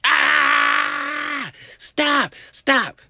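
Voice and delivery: high, full-force terrified screaming; panicked scream. Acted. Runner to attacking driver, panicked high scream. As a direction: scream for your life as you run. Running from car, acted screaming.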